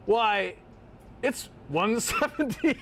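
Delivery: high, slow disbelief; incredulous. Real, not acted. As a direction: drag it out, stunned. Same price for big can.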